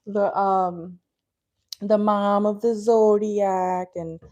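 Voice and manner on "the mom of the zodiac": slow, drawn-out Minnesota vowels; accidental Upper Midwest accent, stretched out. Real, not acted. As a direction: let a Minnesota accent slip out. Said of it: "the accent slipped out a little bit".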